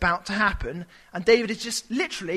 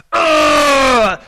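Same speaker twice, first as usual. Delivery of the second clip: loud, high, aggressive grunt; 6 semitones higher, 11 dB louder than usual. Shown as a demonstration. Preacher showing how the mockers really sounded, an aggressive grunt. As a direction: snarl it like a thug. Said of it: "I think it's a little bit more kind of like".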